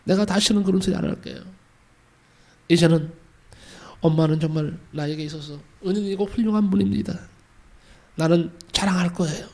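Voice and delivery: a daughter begging forgiveness through tears; tearful apology. Acted. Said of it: "울면서 용서를 빌었습니다". (울먹이며 용서를 비는 목소리)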